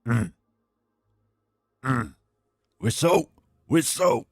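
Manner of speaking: gruff grumbles then a loud shouted "Whistle!"; hammed-up Roy Kent impression; imitating Roy Kent. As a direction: mimic the coach barking at players. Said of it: "it's just how he goes".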